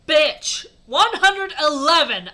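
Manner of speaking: loud, high, drawn-out insult; angry shout. Acted. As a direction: yell it in hammed-up fury. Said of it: "I shouted angrily".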